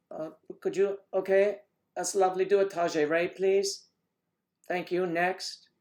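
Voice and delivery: mock casting director.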